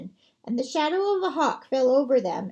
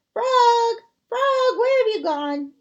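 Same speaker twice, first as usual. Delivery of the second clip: much higher, louder frantic calls; calling out; 9 semitones higher, 6 dB louder, slower than usual. Acted. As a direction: call into the dark for a lost friend. Toad calls for vanished Frog.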